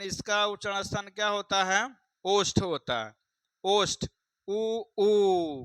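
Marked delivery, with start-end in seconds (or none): 4.5-5.6 उ, then ऊ held longer; demonstrated vowels. Shown as a demonstration. Teacher shows lip-made sounds to students, demonstrated vowels. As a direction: sound out उ short and ऊ long, from the lips. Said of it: "किससे उच्चारण कर रहे हैं".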